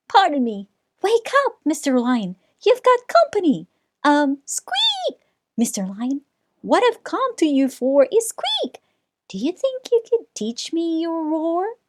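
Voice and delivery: a tiny mouse daring to wake a lion; timid squeaky mouse. Acted.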